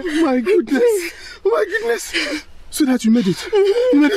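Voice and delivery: high, squealing disbelief; shrieking happy amazement; overjoyed squeal. Acted. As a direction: gush with tearful joy. Friend overjoyed at the news.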